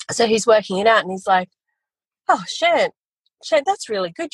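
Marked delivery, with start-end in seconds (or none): 2.3-4.3 high, amazed burst; mock astonished swearing; imitating excited dad. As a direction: retell dad's gasp of delight. Dad reacts to the numbers.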